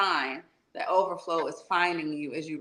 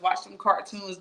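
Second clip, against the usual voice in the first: ordinary delivery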